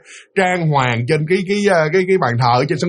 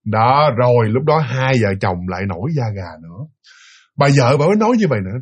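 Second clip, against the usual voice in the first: ordinary talk, nothing marked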